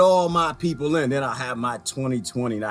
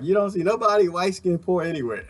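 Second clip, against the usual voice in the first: ordinary talk, nothing marked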